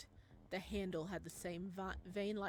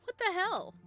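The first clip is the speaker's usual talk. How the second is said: louder, higher disbelief; incredulous outburst; 4 semitones higher, 8 dB louder than usual. Acted. Eden scoffing at Ryohei's ritual, loud. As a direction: scoff at the ridiculous prank.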